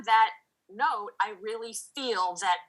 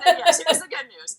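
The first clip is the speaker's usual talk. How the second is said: laughing; 9 semitones higher, 5 dB louder, faster than usual. Real, not acted. Laughing along at good news.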